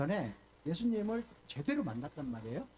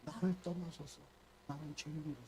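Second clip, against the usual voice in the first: much softer, lower, a kneeling confession; humble plea; 5 semitones lower, 8 dB quieter than usual. Retold or imitated. Peter kneeling to Jesus, quiet and humble. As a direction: hush it as if ashamed before God. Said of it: "그리고 이렇게 말을 해요".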